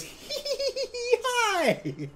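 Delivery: very high, slow squeaky giggle into a hi; happy giggle. Retold or imitated. Madison overjoyed to see Wong, squeaky giddy giggle. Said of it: "She's just so happy to see him".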